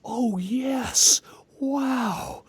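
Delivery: high, airy mock amazement; hammed-up wide-eyed wonder; over-wondrous amnesiac. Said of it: "he's going around like".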